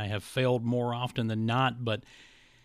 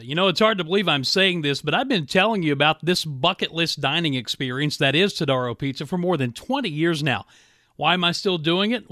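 upbeat, louder radio ad read; 4 semitones higher, 5 dB louder than usual. Acted. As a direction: sell it like a radio spot. Host reads live radio ad.

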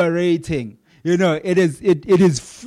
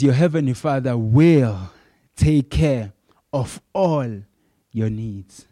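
soothing, fading; 4 semitones lower than usual. Retold or imitated. Paul reassuring, fading.

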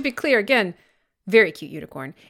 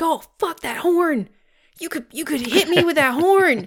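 higher-pitched mock alarm; scared cat; 7 semitones higher than usual. Scared cat to the baby unicorn, mock-frightened. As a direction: overplay a cat's fear of a horn. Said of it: "make fun of him for being scared".

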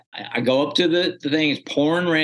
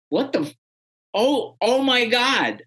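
stunned disbelief; 9 semitones higher than usual. Retold or imitated. Retelling shock at seeing shirt.

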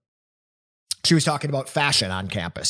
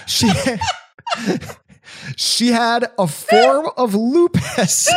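slow words pushed out through giggles; can't hold back a laugh while saying it; 11 semitones higher, slower than usual. Real, not acted. Host reading a grim clue to friends, breaking into real laughter.

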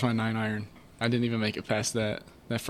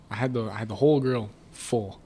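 ordinary delivery

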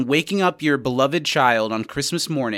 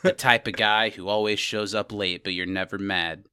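noir narrator; 6 semitones lower, 4 dB quieter than usual. Pretend. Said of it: "a noir voice starts narrating in my head".

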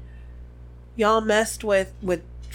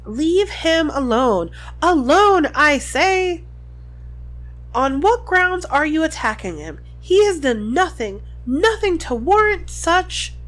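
stern angry teacher; 5 semitones higher, 5 dB louder than usual. A narrator's reading. McGonagall defends Hagrid.

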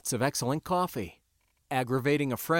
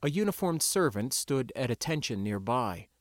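plain reading voice

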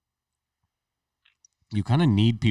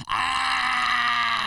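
long high imitation yell; mimicked yell. Imitating a colleague mid-week, a long high yell. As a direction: hold a high, drawn-out "Ah". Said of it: "and he'll go".

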